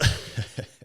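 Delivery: short airy laugh; breathy laugh. Real, not acted. Laughing at own story.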